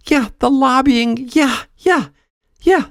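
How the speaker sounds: very high, incredulous agreement; exasperated squeal. Acted. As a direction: pitch it way up in exasperation.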